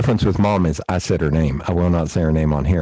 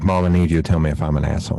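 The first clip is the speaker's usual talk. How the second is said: rushed plea; 4 semitones lower, much faster than usual. Retold or imitated. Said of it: "I was like".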